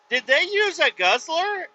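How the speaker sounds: high, slow, drawn-out question; retold puzzled question. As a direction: ask in exaggerated high-pitched disbelief.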